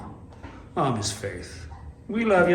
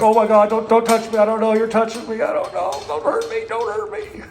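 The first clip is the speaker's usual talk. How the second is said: scared dog voice; 6 semitones higher than usual. Retold or imitated. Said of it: "He's like".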